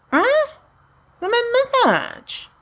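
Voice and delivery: very high squeak; high squeaky mock voice; squeaky high aside. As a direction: squeak it out suddenly.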